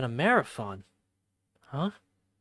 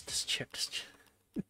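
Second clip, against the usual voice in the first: whispering; 9 dB quieter, slower than usual. Acted. Slow whispered chant.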